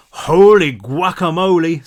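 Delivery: slow, loud, different voice; amazed big guy. A narrator's reading. Truck finds Pan alive, slow amazed exclaim. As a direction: marvel like a big friendly giant.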